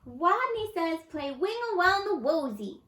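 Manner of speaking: high little voice, a bit bolder; a small rodent calling out the game; timid rodent voice. A narrator's reading. Wadney leads Simon Says.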